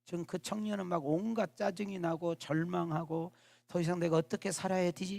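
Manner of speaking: usual speaking voice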